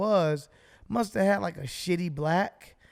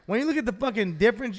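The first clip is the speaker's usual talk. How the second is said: usual speaking voice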